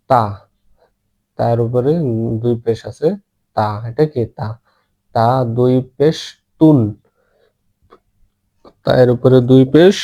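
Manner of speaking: ordinary talk, nothing marked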